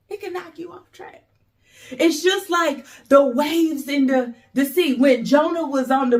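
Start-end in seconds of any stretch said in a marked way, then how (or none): 0.1-1.2 a soft, quick, breathy repeat; hushed echo. Shown as a demonstration. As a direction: drop to near a whisper and let it sink in. Softer echo of the phrase.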